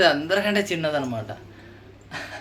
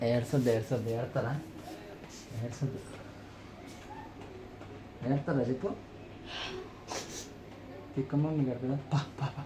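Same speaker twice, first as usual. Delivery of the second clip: hushed, lower soothing voice to a crying sister-in-law; soft comforting; 3 semitones lower, 14 dB quieter than usual. Real, not acted. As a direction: coax her gently out of tears, much softer. (మృదువుగా ఓదార్చే మాట)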